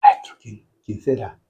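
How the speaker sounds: hushed, careful acted query; discreet question. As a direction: ask it under your breath, halting. Juan discreetly asks the Master.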